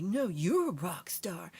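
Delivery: slow, loud mock hype; hammed-up gushing reply. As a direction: fire the compliment back, overdone. Acting out friend's gushing reply.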